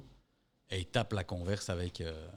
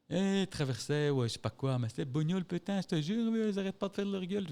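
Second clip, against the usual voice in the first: high-pitched griping that climbs as it goes; mock worked-up rant; 8 semitones higher than usual. Building chief railing about a street run-in, mock outraged. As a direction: imitate an outraged man ranting, pitch rising.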